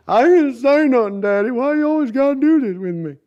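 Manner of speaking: high whiny grown son protesting; sulky son talking back to Daddy. Retold or imitated. Son answers father in story.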